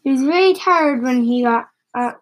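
ordinary delivery

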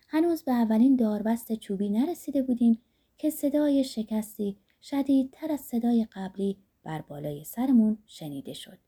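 ordinary delivery

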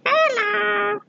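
high cracked skull creature voice; a lightly voiced skull guy. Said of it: "My skull guy voice".

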